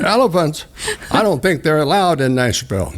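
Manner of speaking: lightly voiced high old lady, startled; a hint of a fussy neighbor lady; shocked old lady. Neighbor lady told about elephants, high and taken aback.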